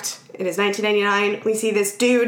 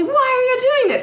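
much higher, slightly louder panicked cry; mock kids yelling; 3 dB louder than usual. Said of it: "the other kids start yelling".

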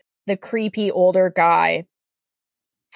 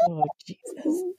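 soft, high weary sigh of a line; exasperated groan; 9 semitones higher, 10 dB quieter than usual. Retold or imitated. Narrator hearing Spider-Man confess, softly exasperated. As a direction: breathe it out, done with this.

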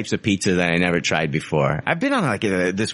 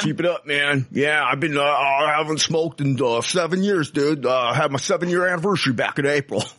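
lightly put-on, slightly higher smug voice; smug ex-smoker; 4 semitones higher than usual. A hint of a smug listener bragging about seven years. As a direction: brag about your quitting anniversary. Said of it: "I talk like this".

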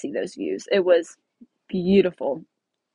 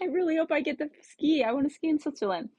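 high wishful pleading, retold; retold pleading hope; 7 semitones higher, faster than usual. Traveller longing to ski in Switzerland, high hopeful plea. Acting out her wish to ski.

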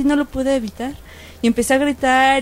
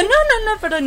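flustered correction; 10 semitones higher than usual. Retold or imitated. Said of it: "y yo".

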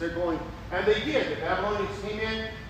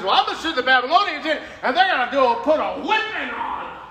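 loud, fast declaration; half-laughing mock verdict; God's matter-of-fact reply; 5 semitones higher, 8 dB louder than usual. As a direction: announce the punishment. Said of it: "God says".

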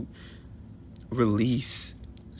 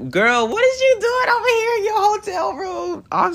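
high squealy laugh-crying; 8 dB louder than usual. Real, not acted. Alone in his hotel room, laughing into tears. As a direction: laugh till you cry, words squeaking. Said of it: "Now I'm crying".

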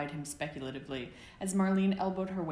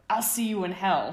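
higher, louder mock roar; 4 semitones higher, 9 dB louder than usual. A narrator's reading. Sirius lunging at James in a pillow fight, hammed-up roar. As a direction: roar it like a deranged villain. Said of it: "Sirius roared".

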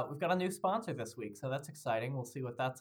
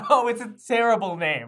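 loud laughing complaint; 4 semitones higher, 10 dB louder than usual. Real, not acted. Laughing at the name.

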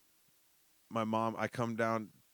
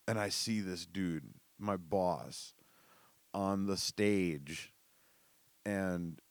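normal talking voice

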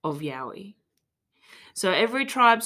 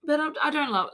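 ordinary delivery

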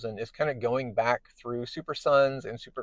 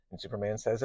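usual speaking voice

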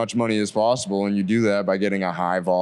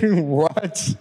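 higher-pitched, drawn-out what; retold incredulous what; 7 semitones higher than usual. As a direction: react in mock disbelief.

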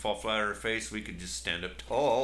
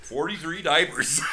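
laughing; 6 semitones higher, 4 dB louder than usual. Real, not acted. Laughs through words.

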